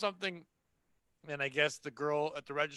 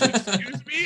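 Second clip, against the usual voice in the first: indignant; 7 semitones higher, 7 dB louder, much slower than usual. Retold or imitated. Customer indignant.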